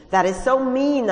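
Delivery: a loud, high shout of hurt; shouting back, high and loud. A narrator's reading. Young woman shouting at her companion in the car. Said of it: "I shouted".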